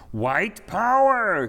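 loud, high, slow refrain; mad, carefully pronounced shout. A narrator's reading. Mad marchers repeat their refrain for confused clowns, slow careful shout. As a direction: shout it slowly, each word carefully pronounced. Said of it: "came the mad refrain, quite carefully pronounced".

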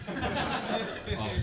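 ordinary delivery

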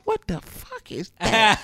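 amazed at a new song; a stunned what the fuck; retold surprise. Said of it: "all I hear is".

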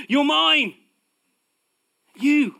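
high, loud proclamation; God proclaiming. Acted. God proclaiming to his people, loud and high. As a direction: call it out like God claiming his own. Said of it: "wanting to proclaim continuously".

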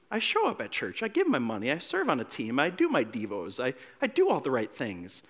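put-on self-righteous older brother. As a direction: list your merits in a hurt undertone. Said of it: "Maybe you relate with the older brother".

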